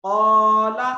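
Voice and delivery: क़ाला with a loud, long 'qaw'; full, thick alif. Shown as a demonstration. Teacher illustrating thick alif, क़ाला with long heavy 'qaw'. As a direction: hold a heavy, rounded first vowel in क़ाला. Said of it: "तो पुर होगा".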